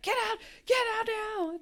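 very high-pitched mock scream; hammed-up shrieked warning; imitated frantic yell. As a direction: urge them out like a panicked caller. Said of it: "the whole time Brenda's like".

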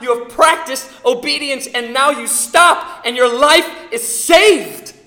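loud, high, slowing preacher's cry; preaching at full force. Acted. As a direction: drive it home from the pulpit.

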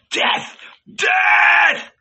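loud, very high mob shriek; maniacal cries. A narrator's reading. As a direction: scream it like a frenzied crowd. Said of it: "there were maniacal cries".

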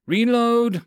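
high, loud battlefield shout; shouted command. Acted. Soldier to team under troll attack, shouted command. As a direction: yell it over gunfire.

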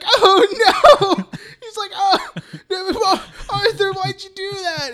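high, loud British panic half-laughing; British accent. Retold or imitated. Retelling Gary's terror, high British-accent whimper. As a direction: squeal like a frightened little Brit. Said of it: "he has, like, a British accent".

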